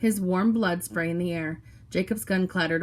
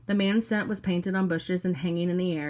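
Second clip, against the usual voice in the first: usual speaking voice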